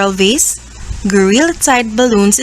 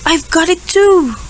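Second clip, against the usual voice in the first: higher sick whimper; crying out sick; 7 semitones higher than usual. Acted. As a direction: cry out, feeling ill. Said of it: "he cried".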